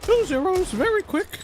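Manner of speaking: mock a hyped co-caster way up high; hammed-up high-pitched impression of a caster. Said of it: "accurate impression as well".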